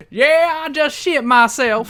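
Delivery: high, loud put-on Dixie voice; Dixie Carter. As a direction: slip into a mock wrestling-promoter voice. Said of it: "I got a little Dixie then".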